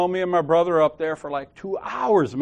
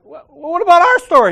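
high, loud whiny protest; a retold loud protest; indignant protest; 12 semitones higher, 10 dB louder than usual. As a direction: object like a wronged kid. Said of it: "I'm like".

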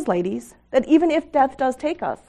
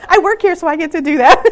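laughing joke; 10 semitones higher, 9 dB louder than usual. Real, not acted. Joking about her own scripture.

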